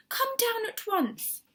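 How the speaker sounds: high, slow eager invitation; eager Missus Silver. A narrator's reading. Missus Silver inviting her neighbour, eager. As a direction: beckon him down gladly.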